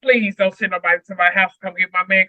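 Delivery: ordinary delivery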